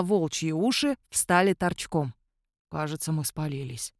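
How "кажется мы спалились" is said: low, soft uneasy mutter. A narrator's reading. Mihai to companions as they are discovered, low mutter. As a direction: mutter it under the breath. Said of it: "пробормотал Михай".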